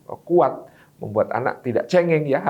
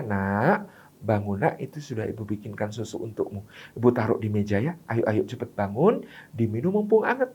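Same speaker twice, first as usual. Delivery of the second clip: lower, softer gentle wake-up; second mother, gentle; 5 semitones lower, 5 dB quieter than usual. Shown as a demonstration. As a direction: calmly tell the child the milk is ready. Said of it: "Ibu kedua membangunkan anaknya". (ibu membangunkan anak dengan lembut, suara lebih rendah dan pelan)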